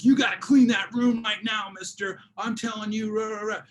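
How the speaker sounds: a mock-stern dad barking about the messy room; dad scolding kid. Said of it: "they're with their kids are like".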